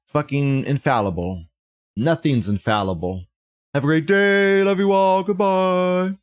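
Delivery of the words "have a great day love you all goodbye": cheery sign-off. Real, not acted.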